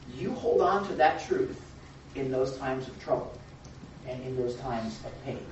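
ordinary talk

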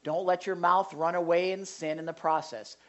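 ordinary delivery

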